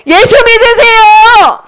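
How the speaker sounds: high, drawn-out street-preacher call; ringing high proclamation, vowels stretched; evangelist's cry. Acted. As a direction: call it out slowly to a crowd. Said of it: "여러 곳에 다니면서 계속 말했어".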